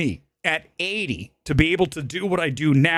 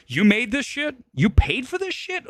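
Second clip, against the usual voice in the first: high-pitched disbelief at the director; incredulous scolding; 10 semitones higher than usual. Acted. Streamer scolding Coppola over his film, high incredulous sneer. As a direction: pitch it up, appalled and mocking.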